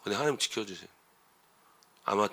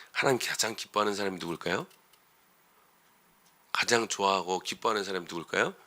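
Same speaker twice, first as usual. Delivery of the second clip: ordinary talk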